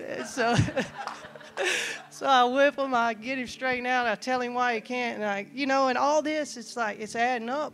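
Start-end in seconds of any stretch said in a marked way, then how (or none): 0.6-2.0 breathy unvoiced laugh rising up; burst of breathy laughter. Real, not acted. Pastor laughing at his own joke with the congregation. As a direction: let out an airy laugh that climbs.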